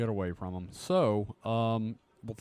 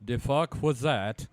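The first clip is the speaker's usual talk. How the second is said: put-on Scandinavian accent; 4 dB louder, slower than usual. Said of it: "I just wanted to do the accent".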